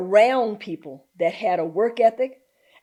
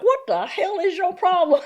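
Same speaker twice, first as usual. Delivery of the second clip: higher, half-laughing retort; mock bewildered challenge; 9 semitones higher than usual. Workers reacting to their boss's twang, mock indignant. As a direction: imitate baffled staff, amused.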